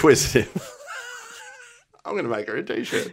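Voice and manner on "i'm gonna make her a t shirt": laughing. Real, not acted. Laughing at the pun.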